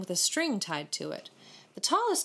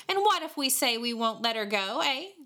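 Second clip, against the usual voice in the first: higher, taunting challenge; mocking; 6 semitones higher than usual. A narrator's reading. A boy challenging David over the kitten, mockingly. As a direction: dare him, lifting high on the last word. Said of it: "laughed a mocking voice".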